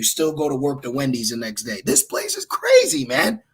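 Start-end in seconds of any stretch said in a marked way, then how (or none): 1.8-3.4 much higher, loud, incredulous; exasperated exclamation. Real, not acted. Preacher to his listeners, exasperated high exclamation. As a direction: throw the line up high in disbelief.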